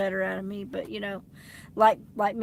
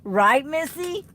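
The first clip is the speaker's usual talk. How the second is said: slow, higher, louder teasing call; 6 semitones higher, 9 dB louder, slower than usual. Real, not acted. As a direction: needle her playfully, drawn out. Teasing viewer by name.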